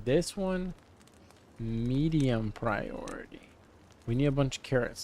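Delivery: ordinary delivery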